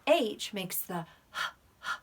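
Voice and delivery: "H" as a breathy h with hot air; H sound. Shown as a demonstration. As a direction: push air out on "H". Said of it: "You can feel the hot air on your hand".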